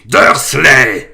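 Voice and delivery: huge furious yell of the name. A narrator's reading. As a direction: bellow his name across the hut. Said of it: "hurla-t-il".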